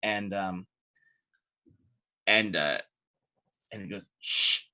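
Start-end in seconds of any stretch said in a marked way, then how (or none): 4.2-4.6 sharp voiceless shush; hushing shush. Acted. Hope Master hushes his muttering knights, a shush. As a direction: hush the men behind you. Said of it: "just kind of hushes them".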